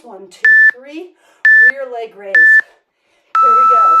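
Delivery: shouted count-in; loud count. Real, not acted. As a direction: count the class in loudly. Coach counts in the exercise.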